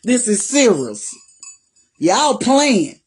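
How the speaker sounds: a light imitation of a stern, no-nonsense voice; Alicia Woodard voice. Repeating her line to the viewers in a lightly stern voice. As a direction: lightly voice her dead serious warning. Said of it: "In my Alicia Woodard voice".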